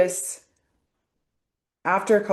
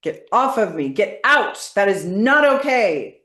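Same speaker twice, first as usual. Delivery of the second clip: loud, higher, forceful shouting; angry release; 3 semitones higher, 5 dB louder than usual. Shown as a demonstration. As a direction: throw them out with your voice. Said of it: "demonstrates anger release statements".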